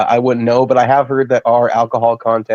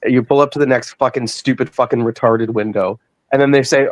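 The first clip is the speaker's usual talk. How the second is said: ordinary talk, nothing marked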